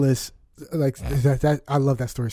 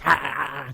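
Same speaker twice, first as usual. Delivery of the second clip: choppy mock laugh; ugly laugh imitation; 3 semitones lower, much faster than usual. Imitating little sister's ugly laugh, mock. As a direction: imitate her honking laugh. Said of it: "She laughs like this".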